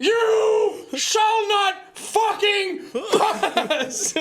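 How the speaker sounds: hammed-up wizard yell through laughter; mock Gandalf shout. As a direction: bellow it slowly like a wizard, cracking up. Gandalf line spoofed, shouted laughing.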